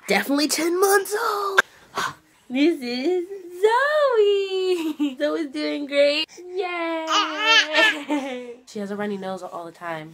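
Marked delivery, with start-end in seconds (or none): 0.1-8.5 high, loud cheering for babies. Real, not acted. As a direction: show off the babies, stretching words. Shows babies, talks over them.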